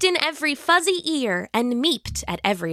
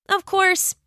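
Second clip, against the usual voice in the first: usual speaking voice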